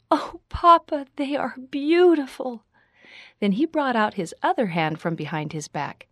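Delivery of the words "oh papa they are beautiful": high, louder delighted squeal. A narrator's reading. Daughter receiving whittled needles from Papa, delighted squeal. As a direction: gush over a gift, pitched way up. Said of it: "squealed with delight".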